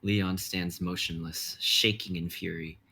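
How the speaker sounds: measured dramatic narration; stage directions read. Acted. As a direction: set the scene like a script reading.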